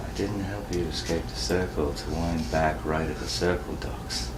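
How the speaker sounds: very soft, secretive undertone; hiss-free hushed reproach; whispering. Acted. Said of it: "and he starts whispering".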